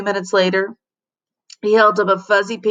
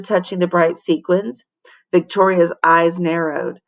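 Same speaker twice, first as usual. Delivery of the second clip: ordinary delivery, nothing marked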